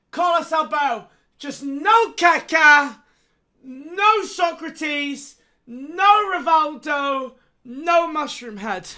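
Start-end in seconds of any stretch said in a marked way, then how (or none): 1.4-8.9 slow pleading. Real, not acted. Begging player outcome.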